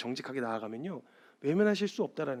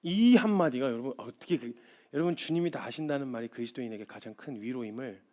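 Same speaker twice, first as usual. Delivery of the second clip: ordinary delivery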